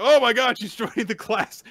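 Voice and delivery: high, loud exclamation of fake surprise; hammed-up "oh my God" reaction; mock shock. Host mocks the transfer student joining the class, mock shocked.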